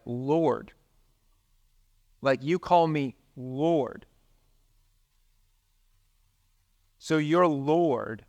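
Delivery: "Lord" drawn out slowly, each time with a falling tune; slow right down and let "Lord" land; slow weight on Lord. Shown as a demonstration. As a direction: savour the word "Lord" slowly with long pauses. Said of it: "we just sort of brush over it".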